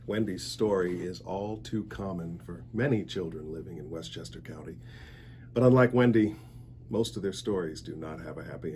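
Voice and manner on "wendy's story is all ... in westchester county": ordinary delivery, nothing marked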